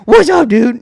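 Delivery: put-on high, loud baby talk. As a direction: greet a little kid, high and loud. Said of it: "dog voices and baby voices are almost the same".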